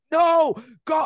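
high, loud retold yell; yelling at screen. Guest re-enacting watching poker with his girlfriend, yelling. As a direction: shout it like a fan at the TV. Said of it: "I'm just yelling at the screen".

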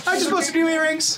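high, quick innocent chatter; change the subject innocently; innocent deflection. Acted.

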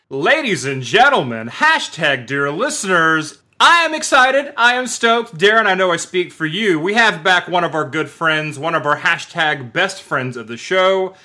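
hyped-up ring announcer welcome; show announcer introduction. Acted. Host introducing a returning guest to listeners, excited announcer style. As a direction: announce the guest big, stretching out the name.

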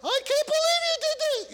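very high outraged parent voice; squeaky high disbelieving parent scold; parent God voice. Pretend. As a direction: shoot way up in pitch like a shocked parent. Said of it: "the higher the octave it goes".

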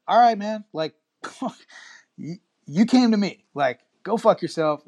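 high, loud, heated mock telling-off; imitating a mechanic losing his temper; retold angry mechanic. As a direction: fire back like an insulted young mechanic.

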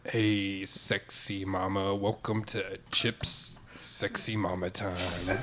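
low, soft, slow seductive come-on; sex-line host. Pretend. Sample of his sex line persona.

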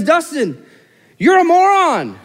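high, loud and slow; blunt divine rebuke. Retold or imitated. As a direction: hammer the word moron as God's verdict. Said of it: "God tells me, he says".

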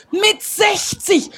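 "Mit sechzig" shouted slow, falling hard on "sechzig"; emphatic cry. Shown as a demonstration. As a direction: hammer "Mit sechzig" home to the crowd. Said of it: "repeats for emphasis".

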